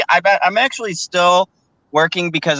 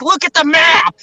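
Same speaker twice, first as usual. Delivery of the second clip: much higher and louder, snapping at a customer; exasperated yell; 10 semitones higher, 5 dB louder than usual. Retold or imitated. Agitated guard yelling at a lost shopper, high and loud. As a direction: bark directions like you've lost patience. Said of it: "I'll be like".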